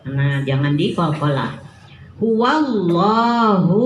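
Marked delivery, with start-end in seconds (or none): 2.2-3.8 slow, stretched Quranic recitation of "Huwallahu"; long vowel demo. Shown as a demonstration. Teacher stretching the vowel for mothers, slow chant. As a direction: draw out "Huwallahu" for two beats. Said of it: "Lo-nya berapa harokat".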